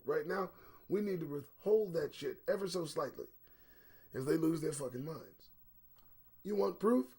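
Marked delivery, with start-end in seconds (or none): none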